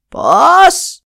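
shrieking high, loud one-word scream; old woman screams at the advisers' choice. Acted. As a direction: scream it in outrage. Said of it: "ούρλιαξε η γριά".

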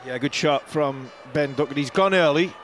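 ordinary delivery

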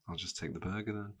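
very soft, resigned retelling; disappointed. Retold or imitated. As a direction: give in quietly, let down. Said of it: "I was really disappointed".

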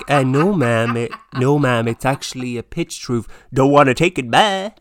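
a polite American realtor brushing her off; American agent. Pretend. Estate agent answering in a loud mock American accent. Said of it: "developed an American accent".

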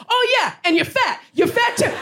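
very high-pitched jeering insult; mock taunting. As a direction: imitate a heckler piling on insults. Heckler's retold insult, acted.

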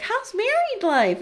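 high, bright bubbly greeting, slowed; bubbly sister. Retold or imitated. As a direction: chirp it like a cheery sister. Sister all bubbly on phone.

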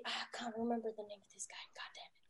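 very soft, near-whispered grumble; muttered aside. Real, not acted. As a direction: mutter to yourself over a forgotten name. Muttering while failing to recall a name.

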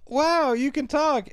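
high, slow gasp of wonder; wide-eyed mock wonder; amazed little girl. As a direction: play the awestruck little girl. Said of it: "the little girl's like".

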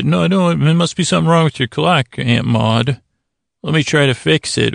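usual speaking voice

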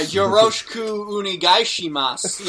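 Yoroshiku onegaishimasu in a careful native-sounding Japanese accent; proper Japanese accent. Shown as a demonstration. Showing a co-host how he greeted the Okinawa film crew, in a proper Japanese accent. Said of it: "in a proper Japanese accent".

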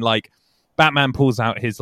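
ordinary talk, nothing marked

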